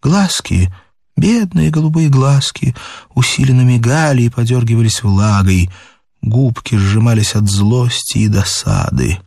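usual speaking voice

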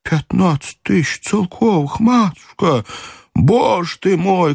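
high, slow wail of dismay; lamenting servant. A narrator's reading. Old servant dismayed at his mistress's loss, high lament. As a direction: lament the lost fortune, drawn out and high. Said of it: "крикнул было Потапыч, умилительно всплеснув руками".

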